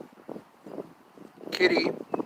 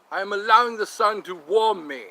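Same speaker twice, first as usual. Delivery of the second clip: affirmation; 3 semitones higher, 7 dB louder than usual. Acted. Mock meditation affirmation.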